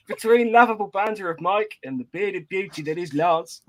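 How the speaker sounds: hammed-up amateur Australian; Australian accent. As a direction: read it in a shaky attempt at Australian. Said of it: "read it out in an Australian accent".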